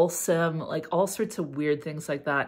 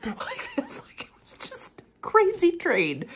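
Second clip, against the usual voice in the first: high laughing squeal; giggling through the words; 14 semitones higher than usual. Real, not acted. Laughing after impression.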